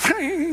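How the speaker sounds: high gliding squeal; playful high sound effect of soaring; flying squirrel noise. Retold or imitated. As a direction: imitate a squirrel sailing over. Imitating man flying over the wall.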